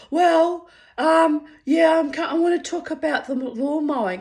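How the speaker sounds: very high, loud stammering mock voice; imitating a flustered man inventing a reason to call; mocking the caller. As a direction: ham up his flustered excuse.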